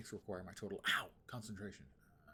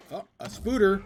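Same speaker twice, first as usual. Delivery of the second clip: much higher, much louder delighted cry; excited; 11 semitones higher, 18 dB louder than usual. Real, not acted. Gamer crying out in delight at a chest find.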